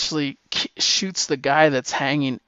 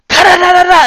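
loud, high mock machine gun burst; machine gun noise; 16 dB louder, faster than usual. Rock opening fire on the Nazis, loud gun noise. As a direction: spit out the gunfire like a kid playing war. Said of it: "and he's like".

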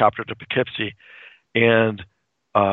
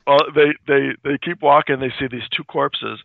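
normal talking voice

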